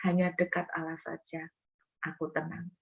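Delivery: soft, near-whispered assurance; hushed calm. Acted. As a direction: let it fade into quiet trust. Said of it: "Daud berkata begini".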